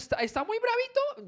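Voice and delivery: playful mock of an angry man; mock teasing. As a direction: needle him like a scolding parent. God teasing Job in paraphrase.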